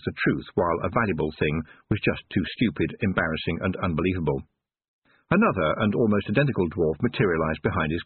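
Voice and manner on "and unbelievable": ordinary delivery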